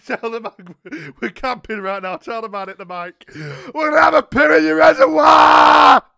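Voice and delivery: high, very loud theatrical yell; mock wailing shout. Caller yelling the prank confession at the operator. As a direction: bellow it at the phone.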